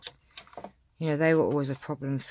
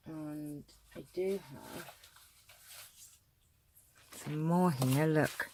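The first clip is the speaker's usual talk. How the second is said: talking around a pin held in the lips; mumbled words past a pin in the mouth; 7 dB quieter than usual. Real, not acted. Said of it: "I've got a pin in my mouth".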